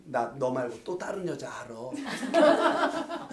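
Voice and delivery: slow, soft teasing confession through laughter; mock confession. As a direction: play a husband confessing a joke. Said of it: "박미수사한테". (아내에게 장난스럽게 고백하는 말투, 느리고 부드럽게)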